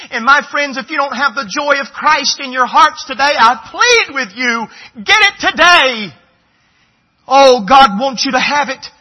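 fervent altar-call plea, voice breaking; impassioned preaching. Acted. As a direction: plead with the congregation at full force. Preaching at full force, pleading.

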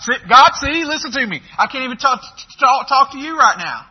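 high, loud, stammering plea; exaggerated stammer. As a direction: ham up a speech impediment, pleading high and loud. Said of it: "he probably really exaggerated whatever speech impediment".

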